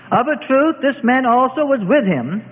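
high, insistent accusation; accusing. A narrator's reading. Servants accusing Peter by the court, insistent. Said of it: "decided to press their suspicions".

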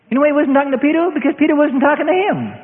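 high, loud, fast punchline; preacher landing the point; emphatic preaching. Acted. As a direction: drive the point home fast.

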